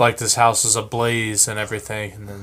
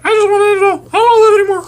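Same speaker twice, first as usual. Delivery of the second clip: squeaky high bird voice, much higher and louder, fast and wailing; whiny suicidal bird; 10 dB louder, much faster than usual. Acted. Friends' improv story, bird PD wails he's done with life, high squeaky voice. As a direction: ham up a despairing pet bird.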